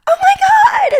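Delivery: high squealing delight; excited shriek. Retold or imitated. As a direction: squeal at baby's little feat. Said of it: "every little thing is like".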